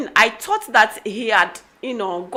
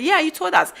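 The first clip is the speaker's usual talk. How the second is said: lightly voiced child, higher; a hint of a child, pitched up; children's reply; 7 semitones higher, 4 dB louder than usual. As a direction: imitate kids agreeing with mum.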